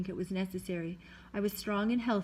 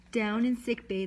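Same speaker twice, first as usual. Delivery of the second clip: usual speaking voice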